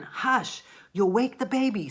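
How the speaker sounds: high, urgent-sounding shush; hushing. Acted. Teacher reading a parent's warning about the baby, hushing. Acted example of hushing someone.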